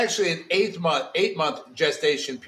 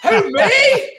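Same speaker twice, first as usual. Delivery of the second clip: mock innocent; 7 dB louder than usual. Real, not acted. Mock innocence after being praised.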